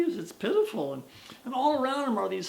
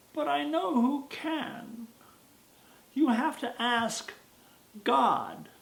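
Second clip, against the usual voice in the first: put-on high, crackly old woman voice; wise old woman; 6 semitones higher, slower than usual. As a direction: play a creaky village crone.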